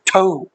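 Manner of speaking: "ठू" with an aspirated th, anglicized. Retold or imitated. A teacher mocking English-speakers, "ठू" aspirated. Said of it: "ज्यादा पढ़े लिखे लोग होते हैं वो ऐसा करते हैं".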